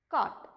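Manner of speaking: "cot" as one short word, no F sound; model pronunciation. Shown as a demonstration. Teacher giving the second word's sound, careful model pronunciation. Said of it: "The second word has the sound".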